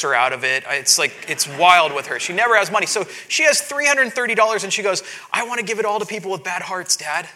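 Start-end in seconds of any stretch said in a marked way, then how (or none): 2.2-7.3 higher and louder, worked up; excited retelling. Retold or imitated. Pastor telling the congregation about his daughter, excited and loud. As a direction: tell it with rising excitement.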